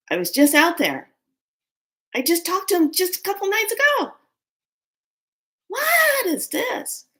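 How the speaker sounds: high-pitched, indignant outburst; angry disbelief. Retold or imitated. Reacting to news of his sudden death, angry disbelief. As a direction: protest in rising, heated disbelief. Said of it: "Now anger's on me".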